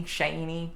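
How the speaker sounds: Shaney with an AY vowel, SHAY-nee; name variant. Shown as a demonstration. Host listing versions of the name, Shaney as SHAY-nee. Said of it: "we've heard so many different, uh, versions".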